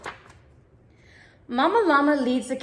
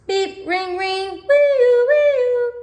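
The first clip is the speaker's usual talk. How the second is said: sing-song wailing siren, much higher and louder; siren imitation; 11 semitones higher, 5 dB louder, much slower than usual. A narrator's reading. Nellie mimics machine sounds, high sung siren wail. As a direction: wail it like a fire engine. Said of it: "her imitation of a siren".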